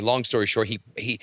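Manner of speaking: usual speaking voice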